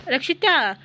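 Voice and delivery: Rakshita called again with a firm falling end; insistent falling call of Rakshita; second call. Acted. (Rakshita ಎಂದು ಇಳಿಯುವ ಧ್ವನಿಯಲ್ಲಿ ಮತ್ತೆ ಕೂಗು)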